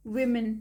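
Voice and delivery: विमेन said WI-men; first vowel of विमेन is "i", not "u"; plural pronunciation. Shown as a demonstration. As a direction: open विमेन with a short "wi". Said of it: "इसे पढ़ते हैं विमेन".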